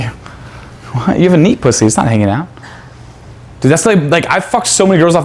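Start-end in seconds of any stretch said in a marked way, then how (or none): 0.0-1.2 whisper. Acted. Whispered aside in the demo.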